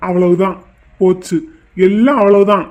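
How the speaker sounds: low, loud groan of defeat; doomed lament. Acted. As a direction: bemoan it as if all is lost. Groom loudly bemoans his ruin. (எல்லாம் போச்சென்ற புலம்பல்)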